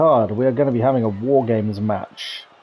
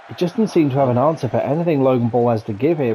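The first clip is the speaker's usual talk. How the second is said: usual speaking voice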